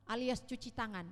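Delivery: ordinary delivery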